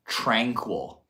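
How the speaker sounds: ordinary talk, nothing marked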